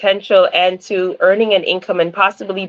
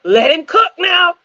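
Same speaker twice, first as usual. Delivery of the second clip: playful, high-pitched catchphrase; quoting TikTok catchphrase; 9 semitones higher than usual. Retold or imitated. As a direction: toss out a hyped catchphrase, pitched well up. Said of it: "remind me of that TikTok account".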